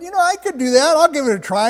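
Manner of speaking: high, loud and keen; eager volunteering. Retold or imitated. One driver takes up the idea, eagerly. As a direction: pipe up, ready to give it a go.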